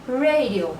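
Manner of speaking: Radio said the English way; English radio pronunciation. Shown as a demonstration. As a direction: read Radio as an English word. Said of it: "só vão pronunciar diferente".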